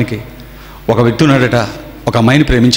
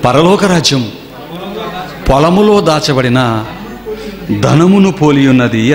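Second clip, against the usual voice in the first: slow, weighty Bible reading; preacher slowly reading scripture to the congregation; solemn scripture reading; 2 semitones higher, slower than usual. Acted. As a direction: read the verse slowly, word by word. Preacher reads scripture verse.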